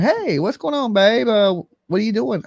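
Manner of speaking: put-on high, sweet-talking boyfriend on the phone; imitating a creepy boyfriend. Boyfriend calling the mother, high mock sweet talk. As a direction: coo it like a sly boyfriend calling. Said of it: "your boyfriend calling you up and going".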